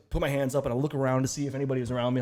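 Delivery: ordinary delivery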